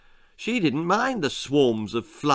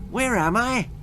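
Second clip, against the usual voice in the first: put-on girl's puzzled question; 10 semitones higher than usual. A narrator's reading. Bessie asks.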